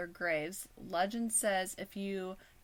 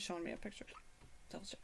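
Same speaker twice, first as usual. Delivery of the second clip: whispered aside; quietly whispered aside; whispering; 12 dB quieter, much faster than usual. Real, not acted. Aside while hiccuping.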